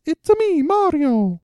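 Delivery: very high mock Italian accent; squeaky, hammed-up cartoon Italian; Mario. Showing cohost an Italian accent, hammed-up cartoon Italian. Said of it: "An Italian accent goes like this".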